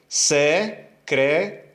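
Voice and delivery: "Secretaria" split into se, cre, ta, ri, a; "Secretaria" broken up with pauses, ri and a apart; syllable division. Shown as a demonstration. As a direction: say "Secretaria" slowly in five separate syllables. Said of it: "Como é que eu divido a palavra".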